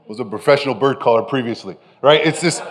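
ordinary talk

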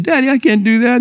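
put-on high-pitched pleading kid; boy protesting to dad. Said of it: "he brings his nine-year-old son".

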